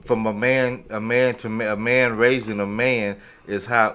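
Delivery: ordinary delivery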